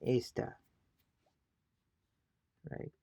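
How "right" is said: usual speaking voice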